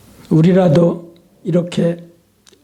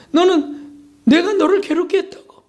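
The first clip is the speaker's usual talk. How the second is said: indignant challenge; 9 semitones higher than usual. Acted. God challenging his people to testify.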